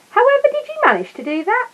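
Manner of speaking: very high astonished question; amazed gasp. Acted. Mr Gruber amazed at Paddington escaping the maze, very high. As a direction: gasp it out in wonder. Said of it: "gasped Mister Gruber".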